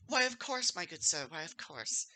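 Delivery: obliging barmaid, high at the start then easing down; kindly older lady. Acted. Barmaid agreeing to the barkeep's orders, kindly older lady. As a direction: sweetly agree like a motherly server. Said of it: "very kindly looking older lady comes out".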